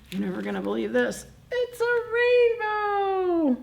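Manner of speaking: पहले तेज़, फिर बहुत ऊँची और खींची हुई हैरानी; माँ भालू की तरह इंद्रधनुष देखकर उत्साह से; excited mama bear. Acted. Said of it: "Mama says".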